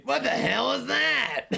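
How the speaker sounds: very high cartoon clown squawk; Krusty the Clown voice. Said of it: "I can do a pretty good Krusty Cr-, Clown impression".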